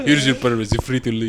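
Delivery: put-on muddled Russian or Indian accent; badly faked foreign accent, somewhere between Russian and Indian; mixed-up foreign accent. Repeating Ishido's line for a laughing friend, muddled Russian-Indian accent. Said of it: "Indian accent".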